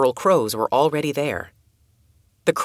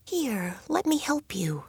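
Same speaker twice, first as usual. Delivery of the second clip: high, much softer coaxing voice; soft and gentle; 9 semitones higher, 8 dB quieter than usual. A narrator's reading. Girl coaxing an injured crow, soft and gentle. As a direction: coax the frightened bird closer. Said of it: "asked the bird softly".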